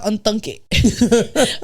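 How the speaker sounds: slow, stretched-out unthunk it; drawn-out retort. Retold or imitated. Telling off the ex on the phone, slow and stretched out. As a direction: stretch the word out to mock him.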